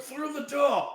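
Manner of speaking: high, strained yell; pained shout. Acted. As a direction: blurt it out in panic. Prisoner answering under torture.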